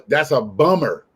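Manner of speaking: BUMMER said the American way, with a full r at the end; slow BUMMER with an American r; American pronunciation. Shown as a demonstration. Explaining the name to an Australian, American BUMMER. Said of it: "It sounds like you're saying".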